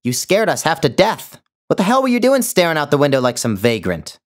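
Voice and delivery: high, loud and fast telling-off; scolding yell. Acted. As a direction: tear into her for scaring you. Woman scolds the late maid.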